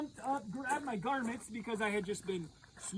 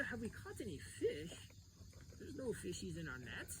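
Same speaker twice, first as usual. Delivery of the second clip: much softer, murmured to each other; hushed murmur; 5 semitones lower, 11 dB quieter than usual. Retold or imitated. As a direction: mutter it low to your friend in the boat. Friends murmuring together in the boat.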